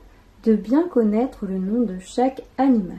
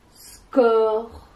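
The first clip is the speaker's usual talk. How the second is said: loud drawn-out "score"; teacher stressing "score" as a first syllable; isolated syllable; 7 dB louder than usual. Shown as a demonstration. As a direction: hold "score" out on its own. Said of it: "La première chose qu'on dit".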